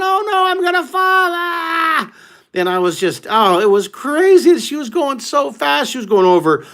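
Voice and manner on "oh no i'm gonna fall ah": high, shrill-free yelp of mock fear; mock panic. Riding Big Mama at speed, mock panicked cry. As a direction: play the rider about to fall off.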